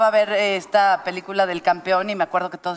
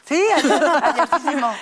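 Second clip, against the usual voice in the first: playful exaggeration; 5 semitones higher, slower than usual. Real, not acted. Joking about release date.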